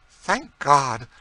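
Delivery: loud, high cry of relief; quick, fervent outburst. A narrator's reading. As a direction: exclaim with heartfelt relief. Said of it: "said Madame de Thou quickly".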